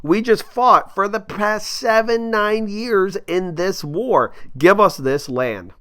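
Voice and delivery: playing the outraged colonist demanding his reward; furious colonists. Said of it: "the colonists were furious".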